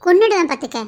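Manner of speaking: loud, scolding threat; mock threat. Cousin scolding him for forgetting his promises, loud. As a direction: shout him down playfully.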